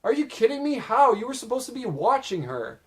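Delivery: imitated outraged scolding, pitched well up; mock yell. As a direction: yell it back in disbelief, as if quoting. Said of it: "Kazu yells".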